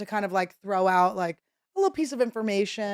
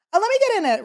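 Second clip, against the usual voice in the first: higher, louder squeal to join in; high eager plea; 12 semitones higher, 7 dB louder, much faster than usual. Retold or imitated. Squeezing into the chef's selfie, high eager squeal. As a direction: beg your way into the photo.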